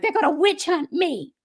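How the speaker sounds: put-on high-pitched worried quote of her old self; mock alarm. Woman retelling her old fear of being witch hunted, mock alarm. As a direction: voice the dread, half playfully.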